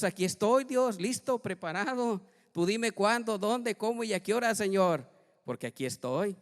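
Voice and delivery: ready-to-go volunteer cry; eager surrender. Pretend. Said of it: "dile a Dios".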